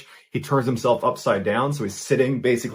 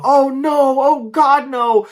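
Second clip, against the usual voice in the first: high, loud cry of desperation; loud high-pitched yelling in horror; panicked yell; 8 dB louder, much slower than usual. A narrator's reading. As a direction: yell it out high as you fall helplessly. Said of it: "he begins yelling out".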